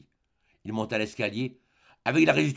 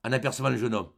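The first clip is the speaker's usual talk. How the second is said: ordinary delivery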